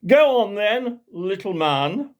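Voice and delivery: high, loud mocking dare; condescending toff. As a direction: sneer down at the little man. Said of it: "Billy Big Head said".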